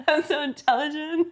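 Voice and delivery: high-pitched teasing imitation of boasting; mocking bragger. Friend teasing her co-host's bragging, mocking imitation. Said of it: "You're just going on like".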